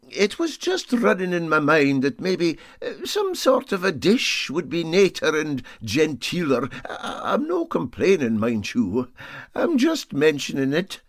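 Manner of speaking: a hint of a fussy Scots skipper dropping a hint. A narrator's reading. Captain voiced in Scots dialect.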